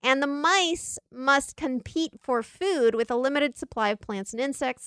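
ordinary talk, nothing marked

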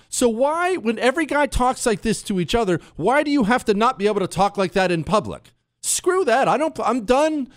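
heated rant, pitched high; angry and spicy. Real, not acted. Ranting to listeners about what's allowed in public, angry and high. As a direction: let the irritation build to a snap. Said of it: "that was a little angry and spicy".

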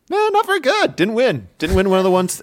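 high, sneering mock voice of the doubters; mocking dismissive fans. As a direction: squeak it out like a scoffing critic. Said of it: "and we're just like".

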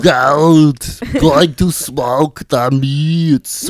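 very slow, booming Austrian action star; Arnold. Retold or imitated. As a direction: stretch every word, loud and hammed-up. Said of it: "[name] has the best Arnold".

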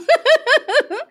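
a sudden high laugh bursting out; real high-pitched laugh; high burst of laughter. Real, not acted. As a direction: laugh out at your own joke, high and quick. Host laughs at own joke.

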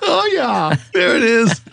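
high, loud mock German accent. Podcaster hamming up German for German listeners, high mock German accent. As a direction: squeal "Oh, yeah" like a cartoon German, "yeah" as "ja". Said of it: "I got my own little German going on".

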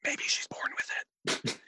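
slow unvoiced ad whisper. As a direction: whisper the tagline slowly. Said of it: "lot of whispering in commercials".

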